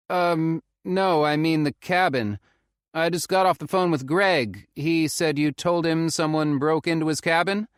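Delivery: put-on urgent young man on the phone; Felix calling. A narrator's reading.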